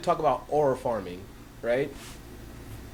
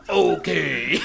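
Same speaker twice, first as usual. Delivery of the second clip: louder laughing okay; laughing imitation; 2 semitones lower, 5 dB louder than usual. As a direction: say it while cracking up. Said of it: "they say the most basic lines".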